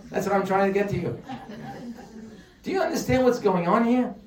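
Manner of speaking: usual speaking voice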